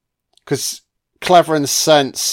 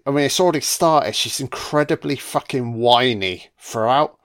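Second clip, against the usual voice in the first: usual speaking voice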